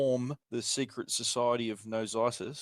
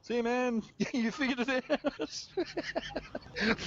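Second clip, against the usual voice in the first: high, slow laughing words, much higher; 12 semitones higher, much slower than usual. Real, not acted. Teasing a friend who figured it out, laughing high.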